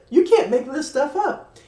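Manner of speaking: laughing it out, high and loud; delighted laughing quote; laughing disbelief. Retold or imitated. Telling viewers about the tree, laughing in disbelief. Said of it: "I was like".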